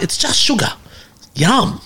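high-pitched gleeful retort; playful relish. Retold or imitated. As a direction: savour it like a cheeky treat. Retold gleeful defence of sweet coffee.

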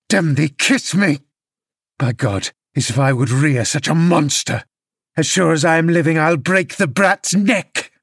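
a hint of a drunk father threatening his child; mild drunken father rage. Drunk father snapping at his crying son, lightly voiced.

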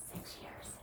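whispered emphasis. Real, not acted. Coach on logging food for years, whispered for emphasis. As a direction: whisper "six years" for effect.

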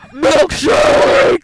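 shout. Pretend. Blooper shouting food names.

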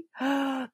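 sharp excited gasp; a quick thrilled intake of breath. Real, not acted. Host thrilled that the book became a film, gasping.

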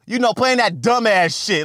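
ordinary delivery

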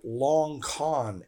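"long con" drawn out slowly, set apart in air quotes; slow down on "long con" as if in scare quotes; wry stretched "long con"; ironic quote-unquote. Real, not acted. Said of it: "quote-unquote".

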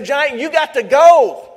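slow, stern, loud command to the giant; all serious. Shown as a demonstration. Pastor shows the crowd how to tell a giant off, serious and firm. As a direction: face the giant down and order it out. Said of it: "you just gotta get all serious and say".

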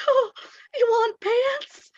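put-on very high, slow childlike plea; imitating the student. Student repeats question to para, high slow childlike plea. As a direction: ask again, pleading.

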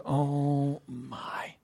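breathy whisper of reluctant dismay. Retold or imitated. Preacher voicing a reluctant believer facing scripture, whispered. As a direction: whisper it like a grudging sigh.

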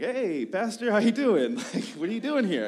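put-on young intern caught with a messy apartment, nervous laughing; his younger self. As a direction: caught off guard, laugh it out loud and high. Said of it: "he's like".

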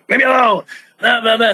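high, annoyed mock brush-off. Wrestler retells shrugging off a stranger's tap, high annoyed mock. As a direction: wave him away, irritated. Brushing someone off in retold scene.

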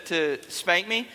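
ordinary talk, nothing marked

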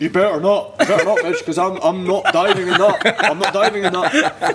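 retold refusal, slowing down to lay down the law; indignant warning. Goalie warning the joker off his prank, indignant.

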